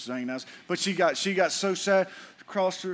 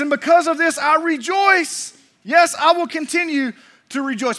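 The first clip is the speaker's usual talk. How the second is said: joyful proclamation; 8 semitones higher, 6 dB louder than usual. Acted. Preacher voices Paul rejoicing.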